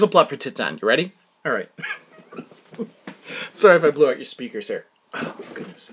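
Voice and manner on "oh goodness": whisper. Real, not acted. Unvoiced breathy aside.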